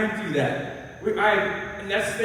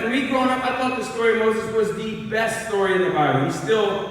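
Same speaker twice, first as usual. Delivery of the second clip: ordinary talk, nothing marked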